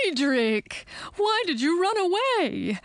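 high-pitched horse's whinny in words; whinny the question out, voice leaping high. A narrator's reading. Horse calls to its runaway owner, whinnying. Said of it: "whinnied the horse".

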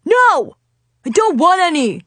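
high, loud outburst; angry yell. A narrator's reading. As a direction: yell it to drive someone away. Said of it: "he yelled".

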